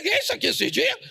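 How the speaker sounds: surprised quote. Retold or imitated. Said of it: "Ele pega, falei".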